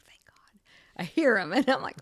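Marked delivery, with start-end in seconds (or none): 0.1-0.6 hushed, voiceless sigh of relief; whispered relief. Retold or imitated. Surgeon hearing the patient speak after surgery, whispered relief.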